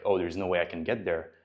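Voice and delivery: normal talking voice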